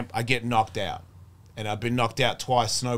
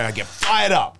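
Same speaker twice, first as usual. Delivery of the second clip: louder, higher burst of hype; fired up; 5 semitones higher, 7 dB louder than usual. Retold or imitated. Retelling a man at a meeting after praying, fired up. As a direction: punch it out like you're pumped.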